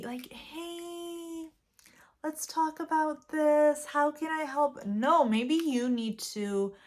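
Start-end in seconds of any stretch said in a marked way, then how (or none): 0.3-4.8 overly gentle. Retold or imitated. Approaching a loved one about weight, too gently. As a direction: tiptoe around them, too careful. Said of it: "if you're always going up to them, like, really".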